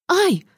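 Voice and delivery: high, short startled yelp; quick acted "ow". A narrator's reading. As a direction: cry out as if hit by a pillow. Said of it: "вскрикнула она".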